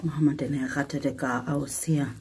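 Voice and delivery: a soft unvoiced whisper. Real, not acted. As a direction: whisper so the animals stay calm. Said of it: "whispered line". (geflüstert)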